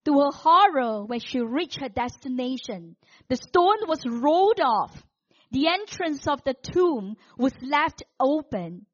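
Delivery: loud, measured, suspenseful storytelling; dramatic preached narration. Acted. Preacher telling the congregation of Mary at dawn, dramatic narration. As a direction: declaim the story with mounting drama.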